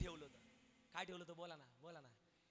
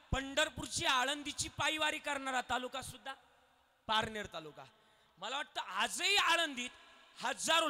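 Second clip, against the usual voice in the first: louder, higher sermon delivery; raised preaching; 4 semitones higher, 6 dB louder than usual. Acted. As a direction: proclaim the taluka's glory to the crowd.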